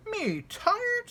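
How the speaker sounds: sharply rising mock disbelief; incredulous, pitch leaping high; surprised grandfather. Grandfather answering Little Bear, mock surprised squeak.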